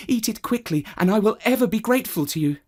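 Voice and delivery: high, hurried woman's voice; urging woman. Acted. Woman urging the cat to eat the mouse, high and fast. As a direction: beg the cat to hurry.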